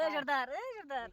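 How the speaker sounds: high, drawn-out hail; calling out. Real, not acted. Worker calling the foreman, high and drawn out. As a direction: stretch the call out slowly to summon him.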